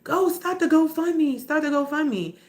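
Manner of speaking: hint of a nagging crowd; mimicking pushy people. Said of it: "I got people".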